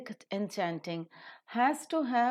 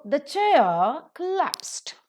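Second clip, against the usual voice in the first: slow, raised, punched beats on CHAIR and col-LAPSED; rhythmic stress; 6 semitones higher, 7 dB louder, much slower than usual. Shown as a demonstration. Teacher shows students the rhythm of the sentence, stressed beats. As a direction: stress CHAIR and the second syllable of COLLAPSED, loud and drawn out. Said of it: "is the pronunciation".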